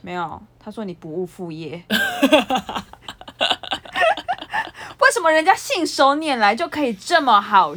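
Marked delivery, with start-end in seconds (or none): 1.9-4.9 shrieking laugh at the joke; high squeal of laughter. Real, not acted. Friend's joke cracks her up. (尖声大笑)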